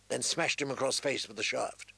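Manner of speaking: ordinary delivery, nothing marked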